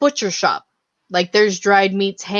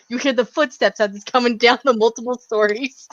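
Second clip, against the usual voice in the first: ordinary talk, nothing marked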